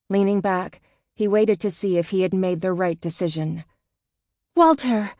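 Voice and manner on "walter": a high, loud call of his name. A narrator's reading. Woman calling her husband from the trailer, loud. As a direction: call him out of the tent. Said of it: "a woman called out".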